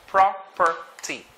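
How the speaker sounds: Property slowly as "prah, per, tee"; slow teacher's demo of Property; syllabicated. Shown as a demonstration. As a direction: break "Property" into three separate syllables. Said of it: "Let's try to syllabicate".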